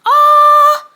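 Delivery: sudden high loud yelp of panic; a quick high cry of alarm; startled high yelp. Retold or imitated. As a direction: squeak out a shocked "Ah". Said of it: "So I was like".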